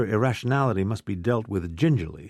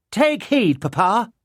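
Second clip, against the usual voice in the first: put-on girl's high, loud proclamation; declaiming; 10 semitones higher, 4 dB louder, slower than usual. A narrator's reading. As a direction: proclaim it like a little herald, very high. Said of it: "she declaimed".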